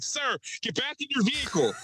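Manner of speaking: high mock cop order; stern officer. Border cop ordering him back in. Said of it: "waiting for someone to be like".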